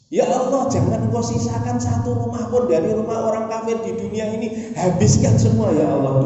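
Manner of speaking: high, urgent plea to God; Noah's pleading prayer. Acted. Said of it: "Doa Nabi Nuh ngoten". (doa Nabi Nuh yang memohon dengan nada tinggi)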